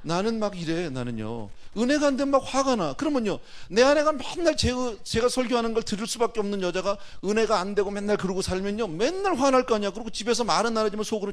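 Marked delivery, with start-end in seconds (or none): 1.8-11.3 preach. Real, not acted. Preaching at full force.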